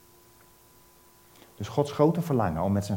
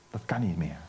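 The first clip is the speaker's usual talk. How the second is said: lower, soft whisper to close the point; 5 semitones lower, 6 dB quieter than usual. Acted. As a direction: hush it like a grave secret. Preacher drops to whisper.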